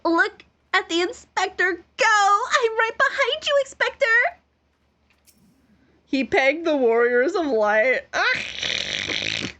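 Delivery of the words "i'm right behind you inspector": high, excited sidekick call; thrilled follower shouting after his hero; eager cheer. Acted. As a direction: cheer them on like a fanboy.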